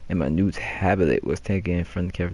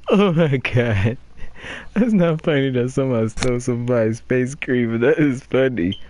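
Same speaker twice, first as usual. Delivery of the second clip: laughing; 6 semitones higher than usual. Real, not acted. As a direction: crack up over a silly notice. Laughing at a stolen-cream poster.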